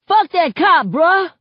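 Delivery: loud, high-pitched angry yell; angry shout. A narrator's reading. Guy with a baseball bat to the crowd, angry shout.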